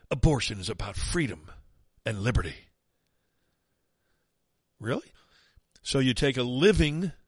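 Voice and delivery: usual speaking voice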